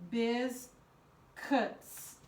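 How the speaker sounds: "Biz cuts" as two separate, fully pronounced syllables; syllable-by-syllable spelling pronunciation. Shown as a demonstration. Teacher repeating for young spellers, "Biz cuts" split in two. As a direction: pause between "Biz" and "cuts".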